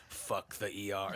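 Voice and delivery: mock stubborn refusal; shrugging off the hospital; dismissive tough guy. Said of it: "He's like".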